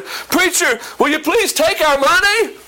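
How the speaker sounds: high-pitched acted plea; begging. Church members turn around to their pastor, begging. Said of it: "begging the pastor".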